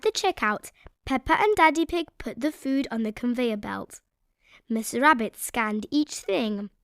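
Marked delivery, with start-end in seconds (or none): none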